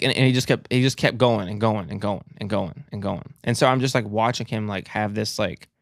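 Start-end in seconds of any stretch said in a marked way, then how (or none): none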